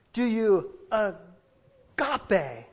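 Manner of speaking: very slow, each syllable of "agape" stretched; quoting Jesus, dwelling on "agape" very slowly; drawn-out agape. Shown as a demonstration. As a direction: savour the word "agape" slowly and deliberately. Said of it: "The word is agape".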